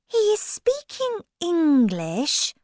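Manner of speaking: put-on high-pitched girl character voice; Alice. A narrator's reading. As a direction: ask it high, at normal volume.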